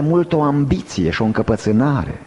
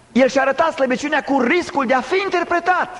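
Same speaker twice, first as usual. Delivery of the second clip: very high, emphatic pulpit cry; forceful preaching; 13 semitones higher than usual. Real, not acted. Preacher defending Christ's show of weakness, forceful preaching. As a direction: drive it home at a high pitch.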